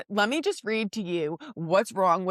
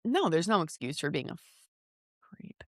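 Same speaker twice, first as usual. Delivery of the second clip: ordinary talk, nothing marked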